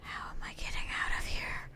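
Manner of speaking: voiceless, furtive whisper. Real, not acted. Player stuck looking for the way out, whispering. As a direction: whisper it to yourself.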